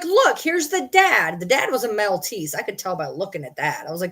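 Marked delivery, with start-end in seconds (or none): 0.0-1.4 imitated sellers. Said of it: "And they were like".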